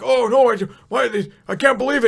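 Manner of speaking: high, loud, stammering guard in shock; stuttering panic. Retold or imitated. Palace guard stammering in shock, high and loud. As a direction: trip over every word in alarm. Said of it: "kinda, like, stuttering and everything else".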